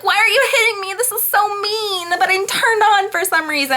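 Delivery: high, whiny baby voice, mocking her; mock whiny Anna. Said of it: "And she's like".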